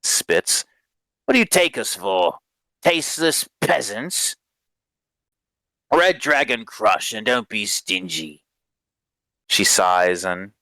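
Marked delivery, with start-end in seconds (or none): 1.2-8.4 slightly raised, scornful demand; mildly haughty drunk's disdain; scoffing. Acted. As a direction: scoff at the cheap offer. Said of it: "he kinda scoffs".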